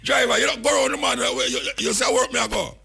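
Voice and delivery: high-pitched imitation of a rider pleading with the driver; mock frantic passenger. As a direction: squeak it out like a panicked passenger. Acted passenger yelling at driver.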